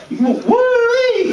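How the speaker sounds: very high hammed-up American yelp of joy; mock American whoop. Comic mocking Americans, high American whoop. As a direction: squeal it like a cartoon American. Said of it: "that's how we talk".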